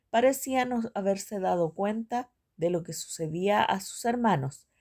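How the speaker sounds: usual speaking voice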